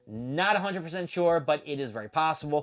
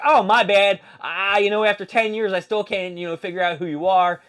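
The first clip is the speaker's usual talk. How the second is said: higher, loud, hammed-up mock apology; 5 semitones higher, 7 dB louder than usual. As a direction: imitate a wrestler faking an apology, raised voice. Voicing a wrestler's mock apology.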